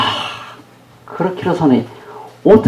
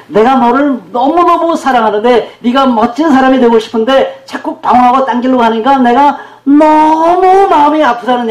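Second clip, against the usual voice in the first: anguished parental plea; 7 semitones higher than usual. Acted. Parent to a child who keeps straying, anguished plea. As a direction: plead with aching love, stretching the words.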